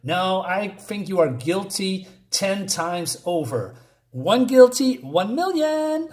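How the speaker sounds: mock bickering. Reviewer parodies comic characters trading guilty verdicts, mock bickering. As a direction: escalate a silly argument, stretching the last words. Mocking a circular comic-book argument.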